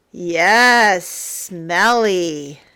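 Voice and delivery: usual speaking voice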